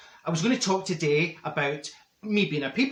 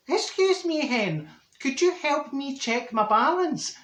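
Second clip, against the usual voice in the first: put-on high, slow quavering old woman; 9 semitones higher, slower than usual. Old woman in the bank queue asking for help, high and slow. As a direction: pitch up and drag it out like a frail granny. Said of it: "she turns to me and she says".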